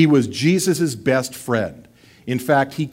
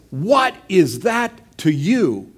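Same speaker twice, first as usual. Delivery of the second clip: Jesus rebuking; 5 semitones higher, slower than usual. Acted. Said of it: "And Jesus says".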